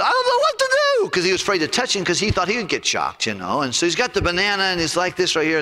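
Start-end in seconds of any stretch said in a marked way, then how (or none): 0.0-1.1 squeaky, very high and fast mock panic; hammed-up helpless squeal; panicked bystander. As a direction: blurt it like a flustered guy holding a banana. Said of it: "he went".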